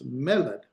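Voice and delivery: "meled" as ME-led; stress on first syllable. Shown as a demonstration. Teacher applying the accent mark, "meled" stressed on ME. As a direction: hit the opening of "meled", lighten the end. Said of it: "the accent's here on the first".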